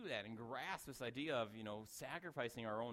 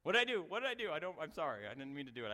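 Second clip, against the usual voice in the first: high, loud panicked apology; startled kids; 4 semitones higher, 8 dB louder, faster than usual. Retold or imitated. Startled children apologising to the shouting pastor, high and panicked. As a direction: blurt it out like a scared kid caught off guard. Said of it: "All the kids just".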